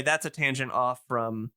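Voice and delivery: ordinary talk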